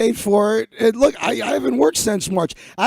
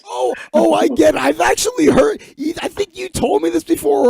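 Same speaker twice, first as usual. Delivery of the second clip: much higher, excited shout of getting it; sudden realization; 9 semitones higher than usual. Real, not acted. Host cracking up at the Spartacus punchline, excited shout. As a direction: burst out as the joke lands.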